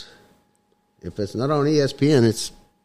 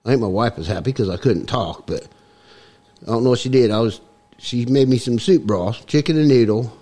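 comic mock-Cockney accent; put-on Cockney accent. Guest explains his voice to the host, put-on Cockney accent. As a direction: talk in a hammed-up Cockney accent. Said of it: "it's a little bit Cockney".

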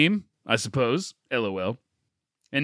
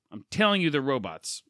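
ordinary talk

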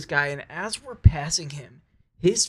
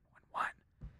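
whisper; 19 dB quieter than usual. Retold or imitated. Signalling the girls near the man.

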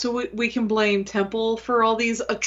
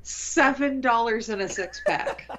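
laughing. Real, not acted. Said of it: "what do you think the budget was".

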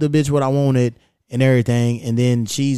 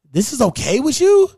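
pitched way up, disbelieving and indignant; incredulous question; 11 semitones higher, 3 dB louder than usual. Retold or imitated. To the server about the forgotten order, high incredulous disbelief. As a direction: ask it like you can't believe they'd accept this. Retelling complaint to the restaurant staff.

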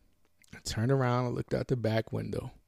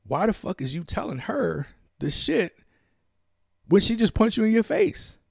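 rising, louder inner voice shouting at himself; inner voice angry; 9 semitones higher, 4 dB louder than usual. Retold or imitated. His inner voice yelling at him in disbelief, loud and high. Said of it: "the inside of me is like".

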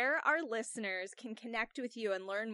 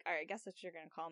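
low, soft, fast resigned mumble; 6 semitones lower, 9 dB quieter, faster than usual. Retold or imitated. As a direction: give in with a shrug in the voice. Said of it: "and you're like".

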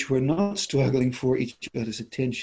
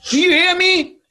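much higher and louder, pushy demand, "you" punched and falling; domineering demo voice; 10 dB louder than usual. Shown as a demonstration. Showing a listener how demanding speech sounds, high loud domineering. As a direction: bark "Do you hear me?" high and loud like a bully. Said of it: "Do you hear how horrible I sounded".

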